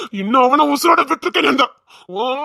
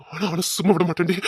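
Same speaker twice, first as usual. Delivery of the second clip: usual speaking voice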